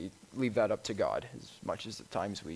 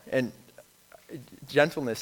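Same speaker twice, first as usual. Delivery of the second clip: normal talking voice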